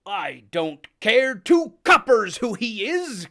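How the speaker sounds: loud gruff sailor; crying out. Acted. Silver shouts about the unpaid bill, loud. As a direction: bark it across the room. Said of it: "cried Silver".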